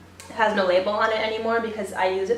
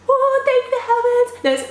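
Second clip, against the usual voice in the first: relieved gasp; 13 semitones higher, 3 dB louder than usual. Retold or imitated. Rescued by English speaker at bank.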